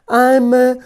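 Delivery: high, slow puffed-up claim; proud moose. Acted. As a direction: announce it grandly.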